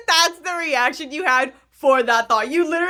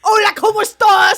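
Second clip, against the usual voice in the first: loud, high mock British Spanish; British accent; 9 semitones higher, 7 dB louder than usual. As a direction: shout it in a hammy British voice. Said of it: "They're not like".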